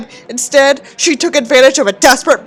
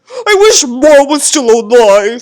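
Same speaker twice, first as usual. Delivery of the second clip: put-on whiny teen; 4 dB louder than usual. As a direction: whine like a sulky teenager at dad. Mock teen whining at father.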